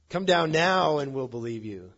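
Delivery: loud call, punched at the start; loud mocking demand. Priests daring Jesus to prove himself, loud and insistent. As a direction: shout the challenge up at him, then let it fall.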